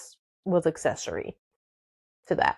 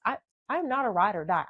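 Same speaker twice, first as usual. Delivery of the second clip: normal talking voice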